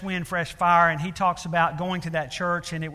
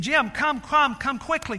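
urgent call; 7 semitones higher than usual. Retold or imitated. Wife runs up calling husband.

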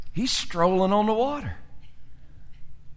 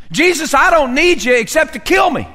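mock yell, higher and louder; hammed-up shout; 8 semitones higher, 5 dB louder than usual. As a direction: yell it at Jesus in overblown despair. Mock prayer rises to a yell.

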